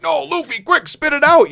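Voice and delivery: urgently warning a friend of danger; panicked Nami. Retold or imitated. Nami begging Luffy to spit out the apple, high and panicked.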